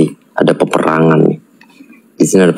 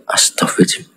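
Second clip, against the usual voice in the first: a soft astagfirullah in a whisper; whispered prayer; 6 dB quieter, faster than usual. Real, not acted. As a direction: mutter it under your breath in a whisper. Praying under breath. (doa berbisik pelan)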